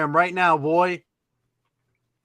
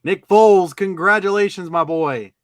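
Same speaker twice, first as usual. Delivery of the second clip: loud, high hype shout; excited congratulations; 4 semitones higher, 8 dB louder than usual. Real, not acted. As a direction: cheer for the winner. Congratulating a winner loudly.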